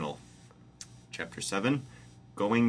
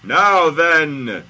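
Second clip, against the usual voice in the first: high, loud shout; God in capital letters; 8 semitones higher, 15 dB louder than usual. A narrator's reading. Host reading God's all-caps calm line, shouting. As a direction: shout what is supposedly calm. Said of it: "it's all in capital letters".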